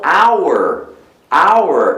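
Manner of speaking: "hour" said as "ow-er"; silent H. Shown as a demonstration. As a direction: start "hour" straight on the vowel. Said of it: "o H é mudo".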